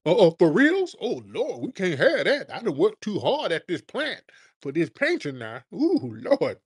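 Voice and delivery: high, swooping mock voice of an alarmed elder; mock-fearful old folks' voice; scared older folks. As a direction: wail it like a frightened old churchgoer, hammed up.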